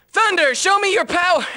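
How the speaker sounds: high-pitched spell call; loud, high incantation on the edge of cracking; straining battle shout. Acted. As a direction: summon the storm at full voice. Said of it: "My voice is gonna break".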